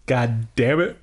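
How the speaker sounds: ordinary delivery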